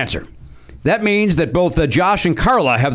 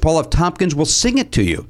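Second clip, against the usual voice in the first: usual speaking voice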